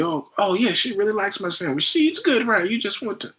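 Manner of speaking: hammed-up delighted cook; mock proud host. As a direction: coo over your own sandwich.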